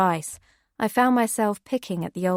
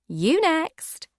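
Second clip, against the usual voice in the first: much higher playful push; encouraging diva; 9 semitones higher than usual. Acted. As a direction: egg her on to drink. Annie offers bottle, encouraging.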